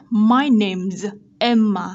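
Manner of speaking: teacher models "name's" ending in z; voiced Z ending. Shown as a demonstration. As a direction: draw out "my name's Emma", voicing the z. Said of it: "được đọc là Z".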